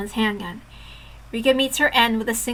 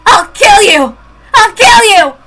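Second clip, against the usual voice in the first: high furious shouts through tears; crying rage; 8 semitones higher, 13 dB louder, slower than usual. Acted. Girl threatening the doctors, crying rage. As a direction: scream the threat, losing control.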